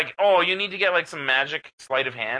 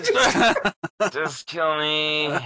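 higher and slow, laughing "just kill me"; mock despair; 5 semitones higher, much slower than usual. Gamer losing to an opponent, laughing mock despair. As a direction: ham up a hopeless groan through laughter.

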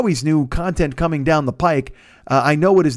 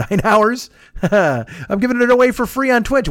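normal talking voice

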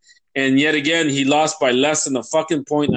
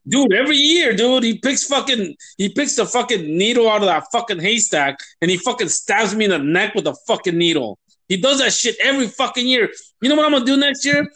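fast, higher-pitched fed-up tirade; real grievance, pitched up and hurried; exasperated rant; 4 semitones higher than usual. Real, not acted. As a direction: rant it out in a worked-up rush. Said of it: "Sorry for my rant".

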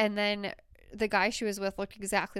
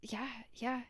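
whisper; 3 semitones higher, 7 dB quieter than usual. Real, not acted. Hushed agreement.

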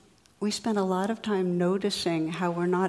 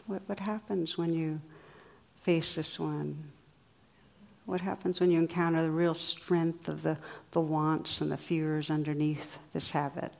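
guided meditation; 4 dB quieter than usual. Acted. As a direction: guide them gently inward. Guided reflection, eyes closed.

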